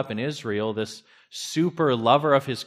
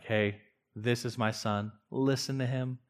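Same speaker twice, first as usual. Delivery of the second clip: soft, lower, tender voice of God; very loving; 3 semitones lower, 7 dB quieter than usual. Shown as a demonstration. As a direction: say it gently, like a loving father. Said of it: "God speaking very lovingly".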